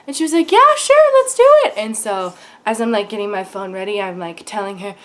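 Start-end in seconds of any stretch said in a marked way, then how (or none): 0.5-1.7 high, loud, chirpy imitation; eager agreement. Actress agreeing to a fan's photo, mock eager. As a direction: mimic an upbeat, eager yes.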